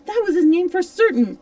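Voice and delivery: shouted high and loud; very excited. A narrator's reading. Silver recognises the blind man's name, very excited. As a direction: burst out in sudden recognition. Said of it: "now very excited".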